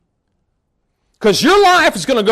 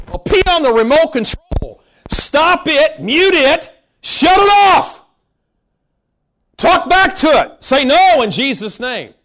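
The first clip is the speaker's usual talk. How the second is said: rally them to talk back; rising, forceful preaching commands; fired-up command; 4 semitones higher, slower than usual. Real, not acted. Urging to silence the TV.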